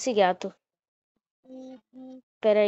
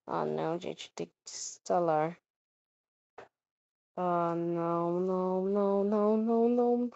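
scared horror game streamer; 8 dB quieter than usual. Real, not acted. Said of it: "Eu tô com medo".

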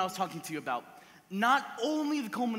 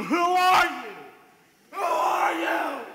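much higher, loud shout; inner shout; 7 semitones higher, 8 dB louder, slower than usual. Retold or imitated. Husband staring at his wife, shouting in his head. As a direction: yell the question at a stranger in bed.

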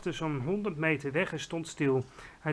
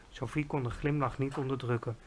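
plain reading voice

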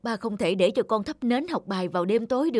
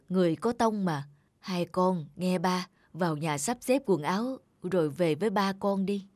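usual speaking voice

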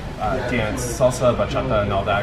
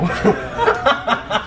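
giggle; 9 semitones higher than usual. Real, not acted. Laughs after naming her.

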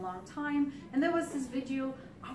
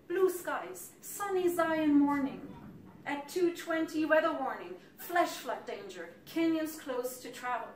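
emphatic recital in short, spaced lines; poem reading; 4 semitones higher, 6 dB louder than usual. Acted. Poet sets the scene before a flash flood, emphatic recital. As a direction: declaim terse verse, starting high and loud.

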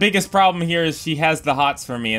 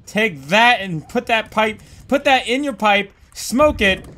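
higher, louder taunting victory shout; triumphant gloating; 6 semitones higher, 4 dB louder than usual. Real, not acted. Player taunting the defeated monster in triumph. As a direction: crow over the beaten monster.

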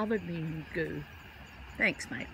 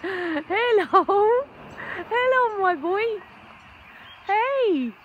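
much higher and louder, gushing at the bird; excited pet talk; 13 semitones higher, 9 dB louder than usual. Acted. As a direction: squeal with delight at the returning bird. Greeting bird Gumbo excitedly.

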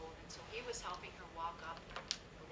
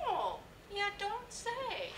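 high, slow, drawn-out imitation of amazement; Newfoundlander neighbour; 7 dB louder, much slower than usual. Neighbour amazed by Rita Joe's answer, high drawn-out mimicry. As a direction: draw it out high and loud, mock-amazed. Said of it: "And she goes".

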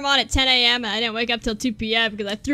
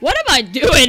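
usual speaking voice

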